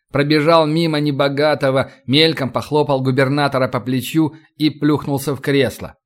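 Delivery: ordinary delivery, nothing marked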